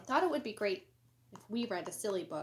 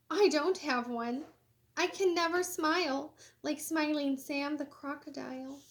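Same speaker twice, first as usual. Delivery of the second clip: lively, sing-song storybook reading; animated picture-book read-aloud; reading to children; 3 semitones higher, 4 dB louder than usual. Acted. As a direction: read it bright and bouncy for kids. Reads quotes of newsman and snail.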